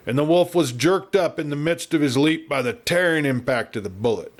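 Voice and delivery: ordinary delivery, nothing marked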